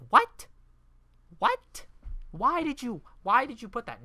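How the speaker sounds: high, loud, baffled questioning; exasperated squawk of disbelief; incredulous outburst. Real, not acted. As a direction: demand why in total disbelief. Baffled rant at the joke.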